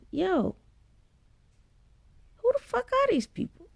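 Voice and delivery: high, shocked question; can't believe who's lined up; baffled outrage. Retold or imitated. Retelling shock.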